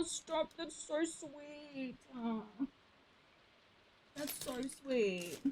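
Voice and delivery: slow, soft, high aww; tender aww. Real, not acted. Streamer melts, slow and soft, over her cat in bed. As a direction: melt at the image, slowly. Cat curled under blanket.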